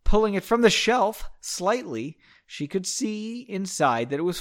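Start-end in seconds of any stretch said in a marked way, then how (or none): none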